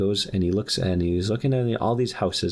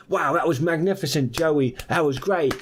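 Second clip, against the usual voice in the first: high, thrilled Aussie showman voice; excited praise; 8 semitones higher than usual. Acted. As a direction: gush in a put-on Australian accent. Australian showman applauds his act.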